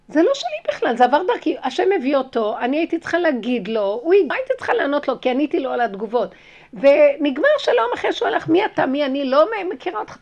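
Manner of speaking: loud, high-pitched declaration; fervent preaching. Real, not acted. Teaching her listeners that nothing is hers, loud fervent preaching. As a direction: proclaim it like a sermon at full force.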